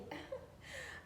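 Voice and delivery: high squealing laugh. Real, not acted. Admiring herself on camera.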